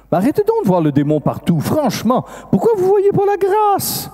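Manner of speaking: high-pitched, insistent reproach; exasperated scolding. Retold or imitated. Priest rebuking those who see demons everywhere, exasperated.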